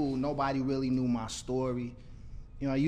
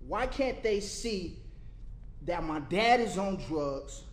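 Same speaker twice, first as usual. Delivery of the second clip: spoken word poem; 7 semitones higher than usual. Acted. Poet reciting to homeless youth, high and impassioned. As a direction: let the poem rise like a question to the world.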